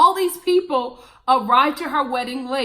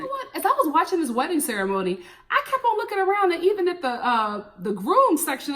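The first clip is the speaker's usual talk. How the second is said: ordinary talk, nothing marked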